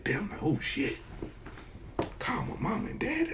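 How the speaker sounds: high, soft panicked squeak; a scared kid realizing the trouble. Retold or imitated. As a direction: kid panicking about his parents being called. Kid panicking at parents call.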